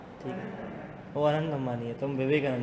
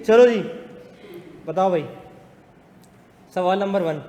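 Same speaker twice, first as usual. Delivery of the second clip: projected, raised classroom voice; louder on request; 3 semitones higher, 8 dB louder than usual. Real, not acted. Teacher starting the first question after being asked to speak up, loud. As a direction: call the class to attention, loudly. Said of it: "अच्छा, ज़ोर से भी बोलूँ".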